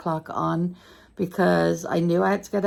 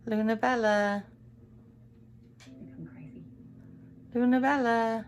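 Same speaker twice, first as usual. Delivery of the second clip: higher-pitched, sing-out pet call; calling the cat; 5 semitones higher, 3 dB quieter than usual. Real, not acted. Vlogger to her wandering cat, calling it. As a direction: coax a cat over by name.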